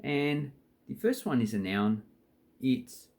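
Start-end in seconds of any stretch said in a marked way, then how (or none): none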